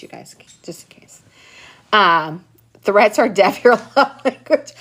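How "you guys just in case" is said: hushed, unvoiced aside; whispered aside. Real, not acted. A secret tip to the viewers, whispered. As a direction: lean in and whisper it.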